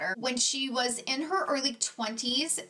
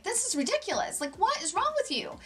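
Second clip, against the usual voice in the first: much higher, exasperated outburst; lashing out; 9 semitones higher than usual. Retold or imitated. Parent lashing out at struggling stepdaughter, exasperated. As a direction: snap at her in frustration. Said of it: "we'd kinda lash out and be like".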